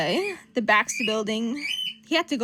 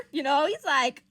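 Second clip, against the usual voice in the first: usual speaking voice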